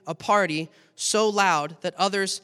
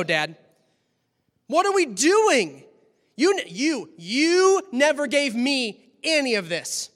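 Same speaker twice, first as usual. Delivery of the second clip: resentful son; 5 semitones higher, 5 dB louder than usual. Acted. Older son complaining to father.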